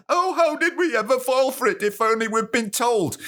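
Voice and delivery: high-pitched mock wail of regret; exaggerated falsetto hand-wringing; mock lament. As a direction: whine it out, hugely hammed-up. Mimics future regretful public.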